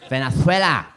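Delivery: loud, hammed-up Spanish accent on Venezuela; exaggerated Spanish accent. Comedian mocks half-Venezuelan flatmate, loud Spanish-accented Venezuela. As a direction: punch out Venezuela with a lisped th for the z. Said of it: "That's how he'd say it".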